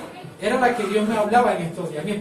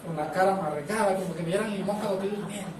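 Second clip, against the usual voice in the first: softer, sour mock grumble of a grouch just woken; grumpy sleeper; 8 dB quieter than usual. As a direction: lightly mimic someone waking up sour, ending in a laugh. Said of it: "hay gente que le pasa como de costumbre". (imitación suave y burlona de alguien que despierta de mal humor)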